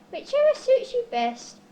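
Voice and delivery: ordinary delivery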